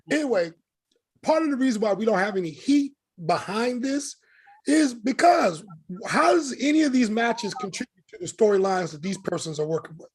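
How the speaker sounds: ordinary talk, nothing marked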